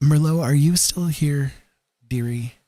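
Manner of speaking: ordinary talk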